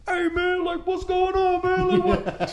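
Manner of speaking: very high, laughing mock voice; curious bar guy. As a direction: pitch way up, amused guy from the bar. Bar guy asking what happened.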